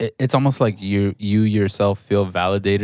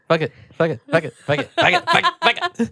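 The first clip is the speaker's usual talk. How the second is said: repeated fuck it cracking into laughter; giggly mock shrug, fuck it over and over; laughing fuck it. Friend imitating her careless old self, laughing fuck it. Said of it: "you were just always kinda like".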